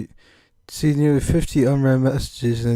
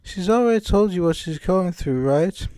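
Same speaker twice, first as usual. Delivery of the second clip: put-on higher, British-accented game character; British accent, pitched up; Monica; 6 semitones higher than usual. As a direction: play her with a raised British voice. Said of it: "can't shake this British accent".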